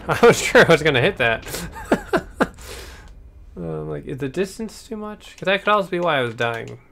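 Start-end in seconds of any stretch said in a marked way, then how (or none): 0.1-1.5 laughing. Real, not acted. Laughs after a success. 1.5-3.5 high airy laugh. Real, not acted. Laughs after a success.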